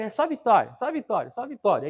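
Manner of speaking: high, loud mock sermon chant of victory; mocking shallow preaching. Pastor mocking other preachers to his congregation, loud mock chant. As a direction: parody a preacher who only promises victory. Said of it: "Às vezes só prega".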